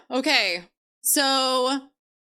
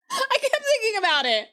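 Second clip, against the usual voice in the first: laughing through the words; 7 semitones higher than usual. Real, not acted. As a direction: giggle it out. Laughing repeat of own line.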